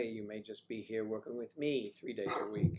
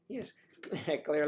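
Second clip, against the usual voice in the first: normal talking voice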